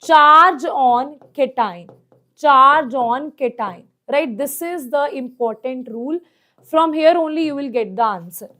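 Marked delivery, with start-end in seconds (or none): 0.0-3.8 each word spaced out slowly; slow emphasis. Shown as a demonstration. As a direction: drill the phrase slowly. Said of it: "inversely proportional to the".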